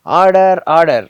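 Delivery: bang the gavel with your voice; judge's order call. Retold or imitated. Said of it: "ఒక judge మాట్లాడుతున్నట్టుగా". (Judge లాగా గట్టిగా order order)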